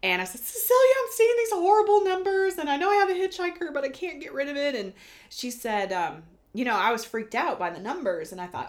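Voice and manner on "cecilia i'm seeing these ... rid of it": high, loud, rushed retold panic; frantic plea. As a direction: pour out the worry fast and high. Retelling her own frantic plea.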